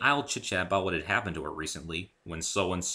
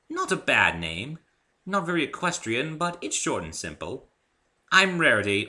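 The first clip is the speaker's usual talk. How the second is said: higher, louder, elegant lady's voice; a gracious unicorn hairdresser; refined Rarity; 7 semitones higher, 5 dB louder than usual. A narrator's reading. Rarity offering Dave a haircut, high and refined.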